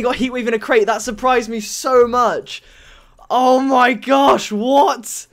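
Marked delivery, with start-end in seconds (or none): 3.3-5.3 drawn-out, raised cry of disbelief; amazed exclamation. Real, not acted. Player still amazed by the crate win, slow loud disbelief. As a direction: stretch it out, louder, in amazement.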